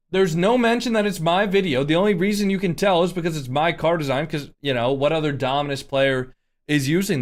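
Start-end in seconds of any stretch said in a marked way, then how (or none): none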